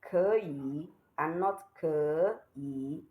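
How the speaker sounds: 可以 first as kéyǐ, then as kěyǐ, slowly; right then wrong tone. Shown as a demonstration. Teacher contrasting correct and wrong 可以, slowly. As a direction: contrast 可以 rising-first with 可以 dipping-first. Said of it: "You say it like".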